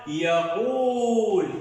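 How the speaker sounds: very slow drawn-out يقول; slow syllable reading. Shown as a demonstration. Teacher to pupils, sounding out يقول slowly. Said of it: "شنو طارق؟".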